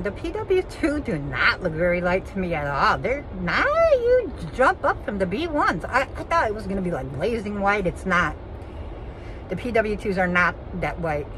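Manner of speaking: ordinary delivery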